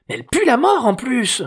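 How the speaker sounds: put-on high-pitched girl's complaint; disgusted teen girl. A narrator's reading. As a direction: exclaim in loud teenage disgust. Girl disgusted by the smelly dog.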